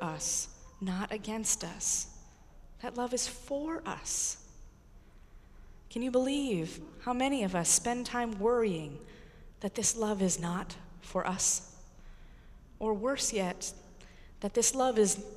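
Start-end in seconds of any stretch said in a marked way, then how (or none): none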